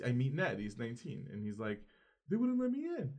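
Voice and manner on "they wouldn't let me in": high-pitched mock complaint; plaintive imitation. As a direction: imitate an upset teen, voice lifted high. Retelling excluded teen's complaint.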